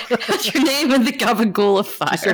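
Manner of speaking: laughing mock Italian accent; hammy Italian gangster accent; bad Italian accent. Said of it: "sorry for the very bad Italian accents".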